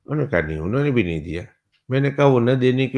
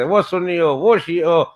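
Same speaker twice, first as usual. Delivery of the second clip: rough banter; 7 semitones higher, slower than usual. Retold or imitated. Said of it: "rough tough بات کرتے تھے".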